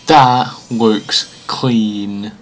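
each word drawn out slowly; slow, savouring. Real, not acted. Fan admiring the graphics, slow and savouring. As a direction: stretch it out in low admiration.

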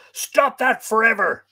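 high, loud outraged shout; roar. A narrator's reading. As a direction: bellow it as an order. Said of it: "roared Fergus".

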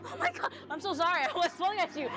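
ordinary delivery

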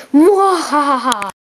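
drawn-out cartoon villain laugh; slow evil cackle. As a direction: cackle slowly, savoring the plan. Villain plotting jailbreak.

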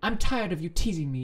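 high, loud whine; whining. A narrator's reading. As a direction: complain in a whiny pitch. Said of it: "you whine".

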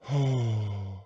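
long, resigned sigh; heavy breath out; deep sigh. A narrator's reading. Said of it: "he gave a deep sigh".

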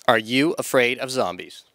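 ordinary talk

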